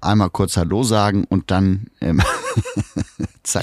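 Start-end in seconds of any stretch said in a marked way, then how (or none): 2.3-3.4 high laugh. Real, not acted. Laughing about sneaking away.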